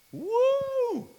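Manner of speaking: high, loud joyful shout; loud cheer. Shown as a demonstration. Showing what Shabach means, loud joyful whoop. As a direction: whoop out with full joy. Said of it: "laut zu rufen".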